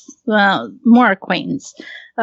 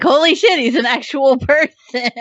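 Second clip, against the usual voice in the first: high, laughing mock disbelief; imitated amazement; 6 semitones higher than usual. Classmates seeing her boyfriend is real, laughing mock amazement. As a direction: play the stunned crowd, breaking into a laugh.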